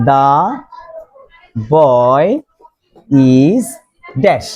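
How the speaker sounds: put-on "the", "boy", "is", "dash", each alone with long pauses; one word, then wait: "the... boy... is... dash"; word-by-word dictation. Shown as a demonstration. Teacher dictates example word by word.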